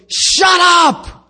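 acted exasperated bellow; shouted rebuke. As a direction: yell it to silence everybody. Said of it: "That's rebuke".

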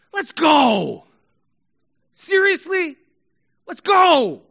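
loud, high protest; disappointed shout. Acted. Crowd after safety flubs the tackle, disappointed shout. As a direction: yell it in disbelief at a blunder. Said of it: "It's disappointment".

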